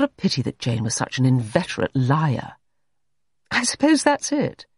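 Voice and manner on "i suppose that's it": high-voiced, reluctant admission. A narrator's reading. Jane admits to Everard the maid is drunk, reluctantly. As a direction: admit it unwillingly. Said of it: "said Jane reluctantly".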